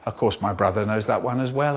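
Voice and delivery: plain reading voice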